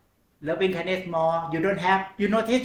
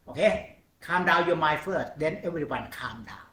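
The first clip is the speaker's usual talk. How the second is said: ordinary talk, nothing marked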